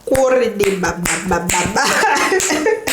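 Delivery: rapid babbled nonsense syllables breaking into laughter; mock speaking in tongues. Mother retells babbling in pain like at church, mock tongues. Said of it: "J'ai commencé à parler en langue".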